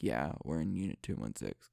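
low, soft sleepy answer; tired voice. Retold or imitated. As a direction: answer like just woken up, drowsy. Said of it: "'cause he was really tired".

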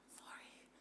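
unvoiced timid sorry; whispered sorry. Australian saying sorry, timid whisper. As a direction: breathe it apologetically. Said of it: "my Australian ways of".

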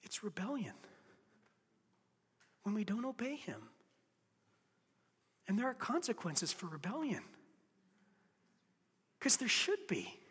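soft, near-whispered pleading; a preacher going quiet to plead with the church; hushed preaching. Acted. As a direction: drop to a hush and draw them in. Hushed intimate preaching after a climax.

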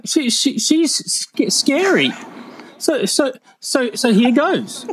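high-pitched, halting nervous stutter; timid high stammerer; stuttering Brian. Acted. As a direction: trip over first sounds, filling with ums. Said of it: "stuttering Brian".

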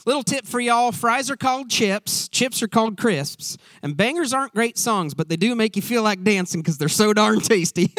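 a cheerful Southern coach giving tips on English food; Southern accent. Retold or imitated. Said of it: "do it in a Southern accent".

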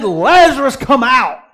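loud, high calling shout; ringing command. Retold or imitated. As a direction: call Lazarus out of the tomb. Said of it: "He said".

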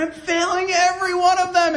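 high-pitched, mildly anguished retelling of weeping; retold weeping. Preacher recalling his breakdown at the conference, retold weeping. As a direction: lift the voice as if re-living the tears. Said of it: "I started weeping".

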